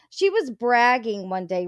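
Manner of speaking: ordinary talk, nothing marked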